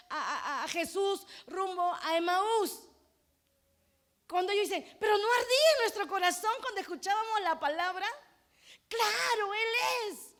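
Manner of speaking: crying out, voice breaking with fervour; impassioned preaching. Real, not acted. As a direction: preach it through tears, full force. Preaching at full force. (predicación a gritos, con la voz quebrada)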